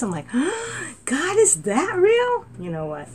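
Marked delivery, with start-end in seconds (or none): 0.4-1.0 high gasp. Retold or imitated. Retold astonishment. 1.0-2.5 high, loud, slow astonished question; reliving awe at the bus stop. Retold or imitated. Retold astonishment.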